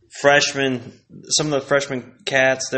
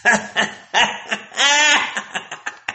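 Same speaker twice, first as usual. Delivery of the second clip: laughing hard; high laugh; 11 semitones higher than usual. Real, not acted. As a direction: break up laughing.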